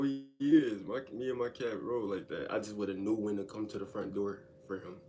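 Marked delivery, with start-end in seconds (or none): none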